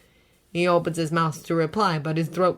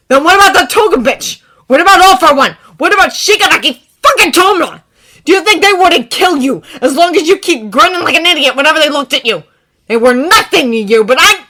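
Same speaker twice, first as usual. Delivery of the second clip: angry; 10 semitones higher, 15 dB louder than usual. A narrator's reading. Bakugo shouting.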